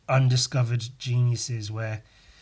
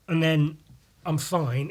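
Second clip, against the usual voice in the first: ordinary talk, nothing marked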